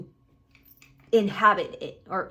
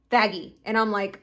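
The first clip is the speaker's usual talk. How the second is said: usual speaking voice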